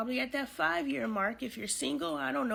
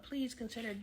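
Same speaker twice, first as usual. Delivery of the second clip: usual speaking voice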